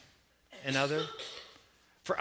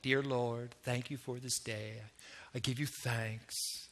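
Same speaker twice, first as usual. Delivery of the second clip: low, soft, measured prayer; mock-reverent hushed praying in lowered pitch; learned prayer lingo; 7 semitones lower, 10 dB quieter, slower than usual. New believer praying aloud in church lingo, hushed and reverent. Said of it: "they kind of learned the lingo now".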